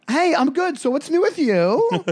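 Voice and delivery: high, over-bright mock small talk; hammed-up upbeat reply; chirpy small talk.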